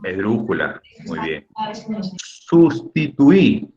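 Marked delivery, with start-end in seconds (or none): 2.2-3.7 "Sustituí" very slow, higher and louder, stretching the final í; drawn-out example word. Shown as a demonstration. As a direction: stretch "Sustituí" out so the class hears the stress. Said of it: "¿qué tipo de palabra es?". ("Sustituí" muy alargado, marcando la í final)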